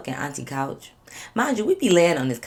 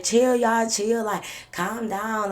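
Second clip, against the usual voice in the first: higher-pitched, drawn-out "chill"; imitated brush-off; 6 semitones higher, much slower than usual. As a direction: wave them off in a mimicked voice. Imitating cousin brushing off their questions.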